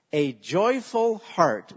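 slow, loud, each word leaned on; a slow chanted recitation for the church to repeat; slow call-and-response. Shown as a demonstration. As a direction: lead the congregation line by line. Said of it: "Would you say that with me?".

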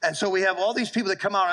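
ordinary talk

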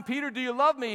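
loud, high, urgent question; preacher's forceful retold plea; insistent question. Third question, most insistent.

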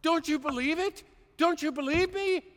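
a loving plea that rises; Lord yelling. Retold or imitated. The Lord calling to doubting believers, pleading loudly. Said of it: "the Lord is yelling at us".

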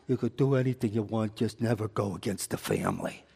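a hint of a movie godfather warning; mafia boss. Preacher lightly mimics a movie mafia boss, low. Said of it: "about the mafia".

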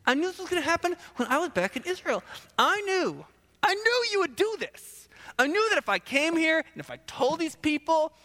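high-pitched petulant rant; overacted accusing tirade; hammed-up indignation. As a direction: build from grumbling to a high, mock-outraged complaint. Jonah rants at God, acted.

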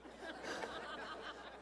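soft breathy laugh; quiet laugh at own joke. Real, not acted. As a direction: let out an airy laugh.

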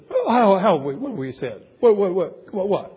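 mock-bewildered stammered questioning; feigned innocence. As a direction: play dumb, stammer as if you did nothing. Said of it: "pretending as though they're doing nothing".

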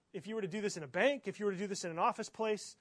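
usual speaking voice

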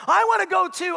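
put-on jealous child. Father imitating jealous son wanting to go too. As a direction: whine like a jealous little brother. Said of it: "the brother gets jealous".

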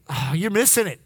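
ordinary talk, nothing marked

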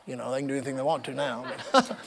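laughing through the words, fast and dropped; amused aside with a laugh; laughing quietly at his own joke; chuckling. Real, not acted. Joke about arrests, laughs.

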